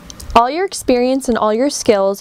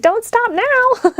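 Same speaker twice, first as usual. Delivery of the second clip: much higher and slow, almost a squeal; squeaky high voice; 13 semitones higher, much slower than usual. Retold or imitated. Reader voicing the person urging them on, very high and slow. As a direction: pitch it way up like the excited spirit urging them. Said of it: "my voice just rose to that octave".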